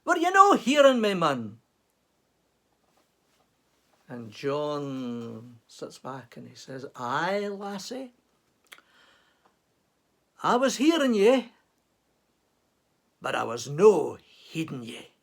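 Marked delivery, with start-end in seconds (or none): 0.1-1.6 higher and louder, a Scottish wife scolding; Scottish wife scolding her husband for not answering; indignant Scottish wife. Acted. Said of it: "with a certain amount of indignation". 7.0-15.1 lightly voiced Scottish crofter, unbothered; a hint of a dry Scots farmer. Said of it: "complete with the accent".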